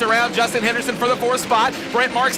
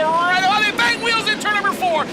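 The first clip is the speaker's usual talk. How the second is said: punchy, pitched-up outburst; excited race call; 6 semitones higher than usual. Real, not acted. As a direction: burst out as the wheels touch. Wheel contact called live.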